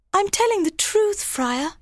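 very high, earnest woman's voice; high, innocent plea; pleading young bride. A narrator's reading. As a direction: insist in a high-pitched voice.

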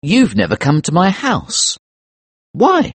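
high, challenging question; indignant, pointed accusation; accusing. Acted. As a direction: challenge him, pitch leaping on the why. Narrator accuses Case.

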